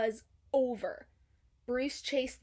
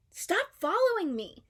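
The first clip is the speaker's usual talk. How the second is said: snappy Dory; 8 semitones higher, 7 dB louder than usual. A narrator's reading. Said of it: "suddenly turned around and said".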